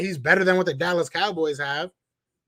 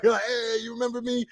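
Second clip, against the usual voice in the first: higher, lightly voiced overeager fan; clingy fan; 7 semitones higher than usual. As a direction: pretend to beg a player to recognise you. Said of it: "I'm gonna be that dude going over like".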